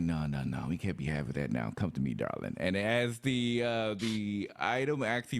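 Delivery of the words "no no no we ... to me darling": Ilya the creature's handler. Acted. Player voicing Ilya calling the creature over, low coaxing reproof. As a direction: drop low and coax, gently disapproving. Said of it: "and we're like".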